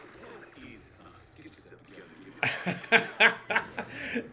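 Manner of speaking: high burst of laughter; delighted laugh. Real, not acted. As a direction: laugh out loud with joy. Laughs at friend on screen.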